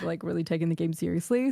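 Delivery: ordinary talk, nothing marked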